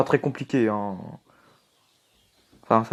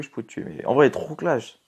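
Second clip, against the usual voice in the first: usual speaking voice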